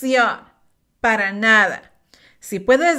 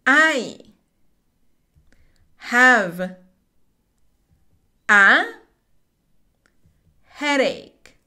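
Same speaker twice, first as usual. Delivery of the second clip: slow, one word at a time with long pauses; teacher drilling each word slowly; 3 dB louder, slower than usual. Shown as a demonstration. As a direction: space out "I have a headache." word by word.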